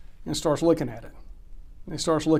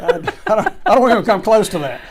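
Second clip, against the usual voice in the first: laughing; 5 semitones higher, 7 dB louder than usual. Real, not acted. Laughing reply.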